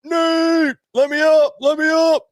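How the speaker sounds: very high, strained mock scream; hammed-up holler for help; retold screaming. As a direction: yell up to a friend from the street. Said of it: "I'm screaming, like".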